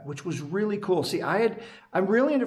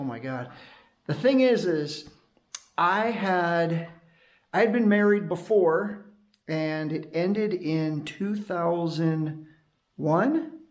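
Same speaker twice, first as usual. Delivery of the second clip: usual speaking voice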